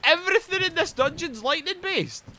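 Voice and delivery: high-pitched incredulous complaint; indignant, squeaky-high question; exasperated disbelief. Real, not acted. Exasperated repeat at the game.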